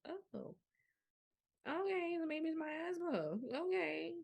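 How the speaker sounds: high-pitched, mock-accepting "oh, okay"; retold uh-oh moment, voice lifted high; acted realisation. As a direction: play a sudden realisation, pitched right up. Acts out her dawning realisation.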